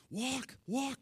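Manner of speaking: high-pitched mock usher's call; ushers calling out. Ushers calling to a running crowd.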